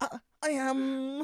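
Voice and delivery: high, loud, slow stammer; Jim. Acted. Oddball neighbour answering who he is, high halting voice. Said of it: "Jim from Friday Night Dinner".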